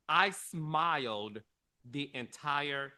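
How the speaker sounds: usual speaking voice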